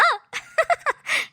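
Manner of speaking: short high-pitched giggle; gleeful laugh. Acted. Host joking with viewers about cicada chips, gleeful laugh. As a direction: giggle high, pleased with yourself.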